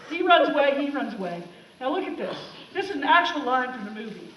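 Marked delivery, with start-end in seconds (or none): none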